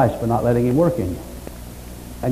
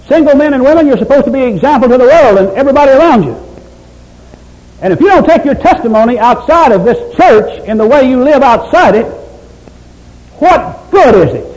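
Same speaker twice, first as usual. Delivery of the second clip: loud, high, fervent preaching; full force preaching; 9 semitones higher, 6 dB louder than usual. Acted. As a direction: thunder it from the pulpit, climbing higher.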